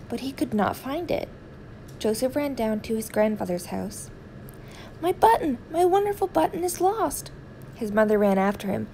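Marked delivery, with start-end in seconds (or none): 5.0-7.3 put-on high, loud distressed boy; a boy wailing over a lost button; upset child.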